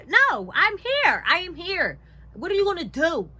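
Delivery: high, forceful, stretched-out challenge; acted defiant shout; loud defiance. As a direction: stand your ground at full volume. Acted defiance toward the cops.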